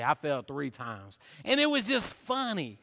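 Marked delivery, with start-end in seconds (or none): 0.0-1.2 sheepish admission. Retold or imitated. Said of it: "they were bragging like".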